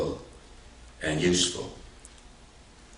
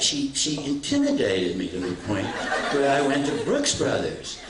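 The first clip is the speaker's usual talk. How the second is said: ordinary delivery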